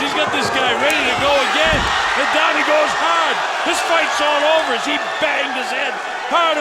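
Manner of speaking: loud, thrilled fight call; excited. Real, not acted. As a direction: ride the knockout hard. Second knockdown called live.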